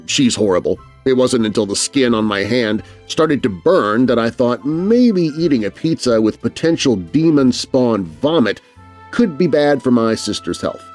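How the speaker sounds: ordinary delivery, nothing marked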